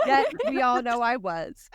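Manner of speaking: laughing through the words; chuckling confession; laughing admission. Real, not acted. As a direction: laugh as you own up.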